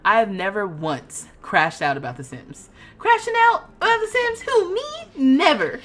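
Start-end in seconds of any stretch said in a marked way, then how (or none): 3.0-5.8 high, innocent mock disbelief; sarcastic denial. Real, not acted. Player jokingly denying her meltdown to viewers, sarcastic. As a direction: play innocent with heavy irony.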